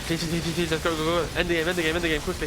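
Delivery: mock urgent pleading; Pure. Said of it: "He was like".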